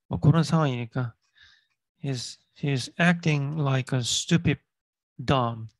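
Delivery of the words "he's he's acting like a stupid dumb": very slow, every word clear; slow model reading. Shown as a demonstration. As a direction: read it word by word for learners. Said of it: "teacher reading slowly".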